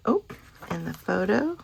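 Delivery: normal talking voice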